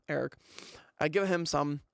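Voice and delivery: ordinary talk, nothing marked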